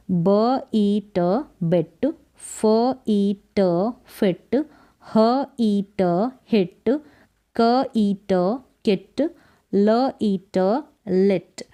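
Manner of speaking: slow phonics, each sound alone, then "बिट", "फिट", "हिट" blended; sounding out letters. Shown as a demonstration. Teacher showing beginners how to read, "ब ई ट" slowly sounded out, then "बिट". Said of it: "ऐसे ही आपको pronounce करना है".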